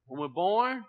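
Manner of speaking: usual speaking voice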